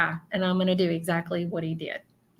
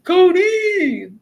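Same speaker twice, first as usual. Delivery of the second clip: much higher, loud call of a name; 11 semitones higher, 8 dB louder than usual. Retold or imitated. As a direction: call your friend from a distance. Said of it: "I don't know why he keeps saying".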